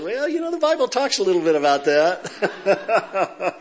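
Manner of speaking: chuckling through the quip; laughing. Real, not acted. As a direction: laugh while delivering the joke. Joking reply about jealousy, laughs.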